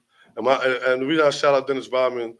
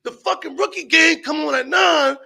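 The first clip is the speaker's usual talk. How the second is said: high, loud hammed-up griping; impatient complaint; 14 semitones higher, 6 dB louder than usual. Crew tired of waiting for the game, impatient shouting. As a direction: imitate a fed-up friend, pitch way up.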